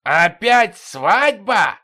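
slow, high, loud howl; king howls in disbelief at the sergeant; royal howl. A narrator's reading. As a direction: howl it so the walls shake. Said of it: "От королевского вопля".

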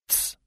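soft hissed "tss"; hissed shush. A narrator's reading. Companion silencing the old man, hissed shush. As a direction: hush him with a quiet hiss. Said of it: "прошипел тот".

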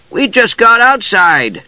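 high, drawn-out kender voice; eager kender. A narrator's reading. Tass informing the others of the escape, high kender voice. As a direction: pipe it up high and slowly.

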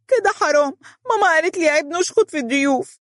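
put-on very high, whiny child voice; crying-out little girl. A narrator's reading. Little girl protesting being yelled at, high wail. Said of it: "فركت مهرة عينيها وهي تصيح".

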